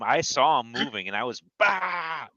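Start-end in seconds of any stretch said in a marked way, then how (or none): 1.6-2.3 short high "bah" burst; reenacted yell while firing; imitated battle yell. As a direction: punch out one raised cry. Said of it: "I was yelling down there at him".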